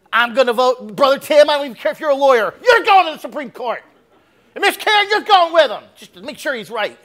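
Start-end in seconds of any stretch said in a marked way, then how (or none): 0.1-5.8 loud, high-pitched playful appointment; mock proclamation. Pretend president appointing church members to the Supreme Court, mock proclamation. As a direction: call it out excitedly, pitched high, as if handing out posts.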